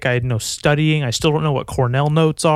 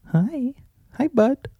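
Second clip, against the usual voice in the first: sweet dog greeting; 11 semitones higher, slower than usual. Real, not acted.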